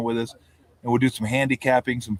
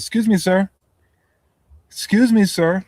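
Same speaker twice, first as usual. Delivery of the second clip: high, louder polite calling after him; flight attendant calling out; 7 semitones higher, 6 dB louder than usual. Retold or imitated. Flight attendant calling after Woody. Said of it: "And she goes".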